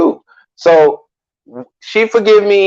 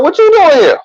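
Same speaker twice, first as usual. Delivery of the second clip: startled; 11 semitones higher than usual. Retold or imitated. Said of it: "I was like".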